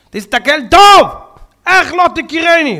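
high, loud royal demand; booming insistence; commanding shout. Acted. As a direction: emperor pressing the rabbi. Emperor insists rabbi recognize him.

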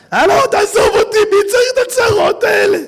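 high, loud, fed-up shout; exasperated yell. Acted. Stressed rich man yelling at his troubles, fed up. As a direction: yell to be left alone.

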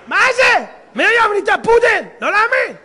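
high, loud cry of disbelief; shocked husband. Acted. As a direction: shout in horrified comic amazement. Husband finds wife became poodle. (צעקת תדהמה של בעל המום)

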